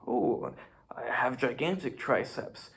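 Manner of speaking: usual speaking voice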